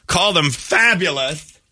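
high and slow, savoring fabulous; mock prissy. Host teasing co-host to say fabulous, mock prissy. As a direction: draw it out like a fussy stylist.

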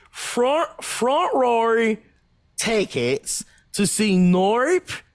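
slow, hammed-up Australian accent; mock Australian. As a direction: order cinema tickets as a cartoon Aussie. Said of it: "our impression of an Australian".

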